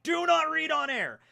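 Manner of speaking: high, loud reading of "Do not read on air."; mock warning label. Shown as a demonstration.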